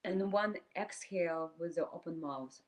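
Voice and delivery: calm meditation voice with long silent pauses; guided meditation. Acted. Teacher leading a group through inhale and exhale, guided meditation. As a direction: guide them breath by breath, leaving space between phrases.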